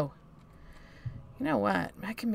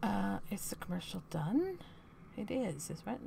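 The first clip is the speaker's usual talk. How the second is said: hushed whisper; 8 dB quieter than usual. Real, not acted. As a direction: whisper so the mic barely hears. Whispering while ad plays.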